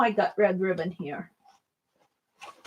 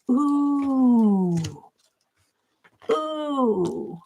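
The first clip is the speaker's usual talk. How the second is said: ordinary delivery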